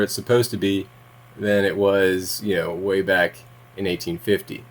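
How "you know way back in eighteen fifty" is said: usual speaking voice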